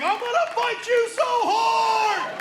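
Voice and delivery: very high, drawn-out mock scream; slow, high-pitched wail of a threat; furious teen threat. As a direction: imitate an enraged son hollering. Son's furious threat from room.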